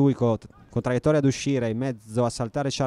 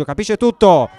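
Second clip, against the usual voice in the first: excited commentary; 6 semitones higher, 9 dB louder than usual. Real, not acted. Equaliser being built, commentator exclaims.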